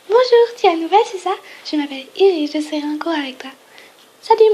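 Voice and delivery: high, bright and friendly schoolgirl; sweet girl voice. Acted. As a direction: greet the new girl cheerfully.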